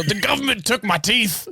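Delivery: high mocking crazy-man voice; toothless whine. Mocking Mel on the street.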